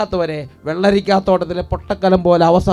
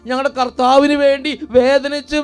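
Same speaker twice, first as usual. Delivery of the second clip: pleading scripture reading; 5 semitones higher than usual. Acted. Reads Isaiah in prayerful pleading.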